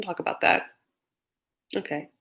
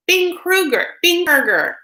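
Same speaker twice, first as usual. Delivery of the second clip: excited greeting; 9 semitones higher, 4 dB louder than usual. Real, not acted. Greeting chat viewer sing-song.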